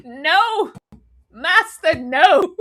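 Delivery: mock anguished plea, building; hammed-up grief, breaking into laughter; acted devastation. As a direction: wail like a loyal squire. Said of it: "Egg was devastated".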